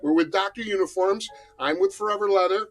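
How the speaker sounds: loud, upbeat talk show host opening; show host intro. Acted. Host greeting viewers, loud and upbeat. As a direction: pump up the audience for the show.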